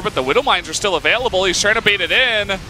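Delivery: fast, high play-by-play; esports caster. Real, not acted. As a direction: hype up the fight as it happens.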